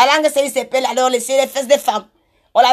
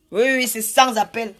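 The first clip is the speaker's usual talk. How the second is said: usual speaking voice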